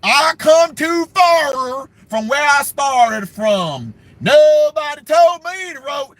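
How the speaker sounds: hammed-up sermon shout; mock preacher Hillary. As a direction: drag it out like a gospel preacher. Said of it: "see how she started talking".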